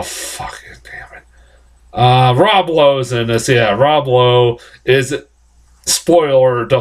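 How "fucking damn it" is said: whispered curse. Real, not acted. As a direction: hiss it to yourself, fed up. Frustrated aside to himself.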